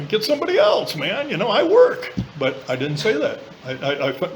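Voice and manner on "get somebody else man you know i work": high, brushing-off retort; high sarcastic inner grumble; mock refusal. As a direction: wave it off in a much higher voice. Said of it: "I was like".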